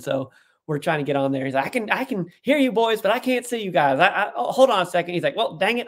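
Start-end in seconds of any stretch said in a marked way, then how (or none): 1.7-5.1 high-pitched folksy uncle on a call; down-south uncle. Retold or imitated. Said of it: "You know, down south". 5.4-5.9 down-south uncle. Retold or imitated. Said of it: "You know, down south".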